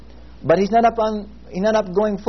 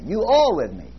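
"are" raised and stressed, then falling. Shown as a demonstration. Preacher restating the verse in modern words, stress on "are". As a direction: hit "are" to affirm it, ease off after. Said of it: "Emphasize every word".

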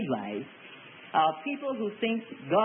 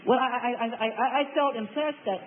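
mock stammer on a higher pitch; stammering hedge; 4 semitones higher than usual. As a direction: stumble repeatedly on "I", higher, as if cornered. Mimics cornered believer stammering.

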